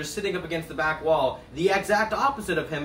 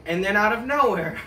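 normal talking voice

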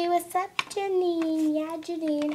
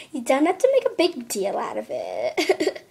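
ordinary talk

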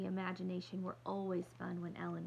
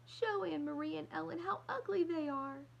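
much higher, indignant order; demanding; 9 semitones higher than usual. Acted. Little girl ordering mother to show the shoes, high and indignant. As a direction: insist in disgust.

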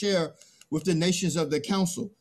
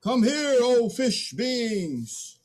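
loud, higher, slow summons; drawn-out loud calling; 6 semitones higher, 4 dB louder, much slower than usual. Acted. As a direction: call the fish in grandly. Man calls out to fish.

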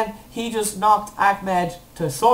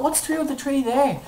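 ordinary delivery

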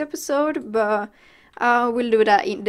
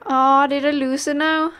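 ordinary talk

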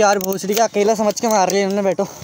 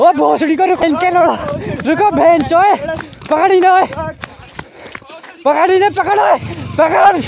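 high-pitched, urgent shouting; yelling after attackers; 5 semitones higher than usual. Real, not acted. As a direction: yell for someone to stop and grab them.

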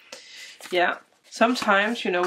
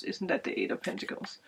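whispered aside; 12 dB quieter, faster than usual. Real, not acted. Doubting aside about the card.

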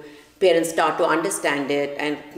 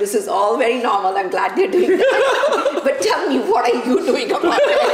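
laughing doctor; 6 semitones higher, 4 dB louder than usual. Retold or imitated. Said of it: "I'm like".

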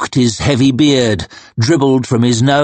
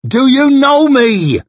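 big man calling out, much higher and louder; worried shout; 13 semitones higher, 4 dB louder than usual. Acted. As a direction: bellow his name to wake him up. Big man calling to fallen Logan.

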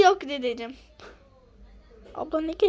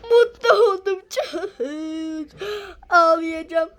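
mock happy crying; 6 dB louder than usual. Grandma moved to tears.

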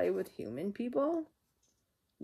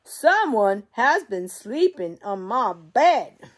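slow, louder booming bear voice; Papa Bear; 4 semitones higher, 12 dB louder, slower than usual. Acted. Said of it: "And Papa Bear goes".

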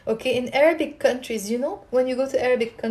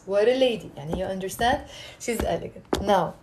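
ordinary talk, nothing marked

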